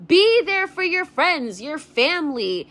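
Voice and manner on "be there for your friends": ordinary talk, nothing marked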